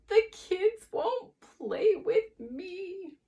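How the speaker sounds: put-on high, slow, loud child's wail; bawling. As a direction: drag out a tearful bawl. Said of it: "he bawled".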